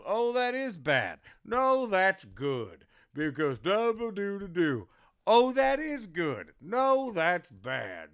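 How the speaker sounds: bouncing puppet routine; vaudeville banter. Retold or imitated. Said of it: "And then he goes".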